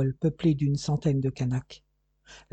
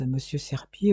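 ordinary delivery, nothing marked

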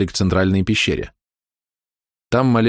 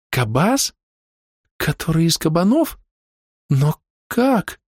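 put-on little girl voice; 10 semitones higher, slower than usual.

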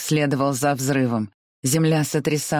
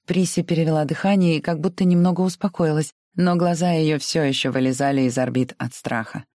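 ordinary delivery

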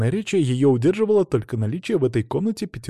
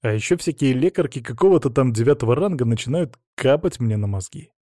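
plain reading voice

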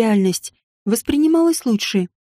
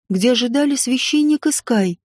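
ordinary delivery

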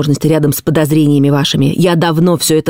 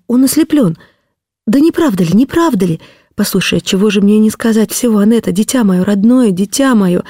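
higher-pitched, halting, pleading woman's voice; anxious coaxing in a raised pitch; distraught pleading wife; 6 semitones higher than usual. Acted. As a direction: beg desperately for it to be untrue.

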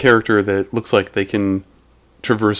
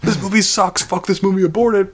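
much higher mock outraged whine; whiny internet troll; 10 semitones higher than usual. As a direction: whine like an angry fan online. Mocking internet rumor complaints.

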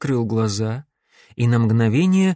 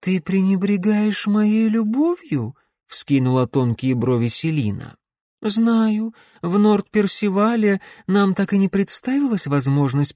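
femme fatale; 7 semitones higher than usual. A narrator's reading. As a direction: lure him in, mock-hurt. Voices the witch.

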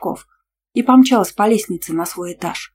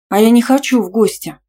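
wide-eyed protest, raised pitch and volume; higher, louder, startled refusal; 4 semitones higher, 6 dB louder than usual. A narrator's reading. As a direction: refuse loudly with wide eyes. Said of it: "выпучив глаза, ответила ему".

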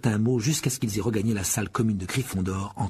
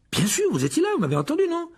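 high, exasperated protest; irritated; 3 dB louder than usual. A narrator's reading. Harry protests to Ron and Hermione, pitched very high. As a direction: push back, getting annoyed. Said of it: "qui commençait à s'énerver".

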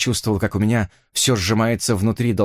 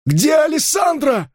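Enso's shout; slower than usual. Acted. Gangster screaming at beaten man, furious shout. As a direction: bark it point-blank into his ear. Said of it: "крикнул он".

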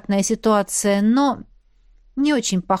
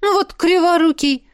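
higher-pitched exasperated cursing; scolding; 8 semitones higher, 4 dB louder than usual. A narrator's reading. Friend cursing a bungling mage, scolding. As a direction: curse the clumsy fool. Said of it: "обругала брюнетка".

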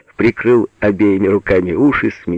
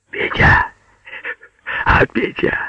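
whispery, careful summons; hushed calling. A narrator's reading. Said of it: "позвал Агеич". (зовёт вполголоса, сипло)

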